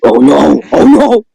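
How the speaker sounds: high, loud mock alarm; mock alarmed cry. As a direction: cry out in hammed-up dismay. Mock horror at story.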